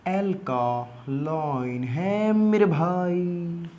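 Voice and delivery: slow, stretched-out syllables; drawn-out emphasis. Shown as a demonstration. Teacher reminding students of the key pH fact, drawn-out. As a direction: draw every word out for the listener.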